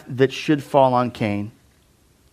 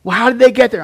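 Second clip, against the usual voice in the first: skeptic pressing; 10 semitones higher, 9 dB louder, faster than usual. Retold or imitated. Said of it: "he would go".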